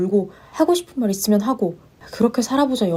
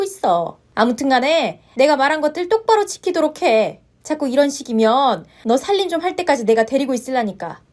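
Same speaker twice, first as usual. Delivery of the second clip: stern mother-in-law; 3 semitones higher than usual. Acted. Mother-in-law scolds her daughter-in-law, sharp and stern.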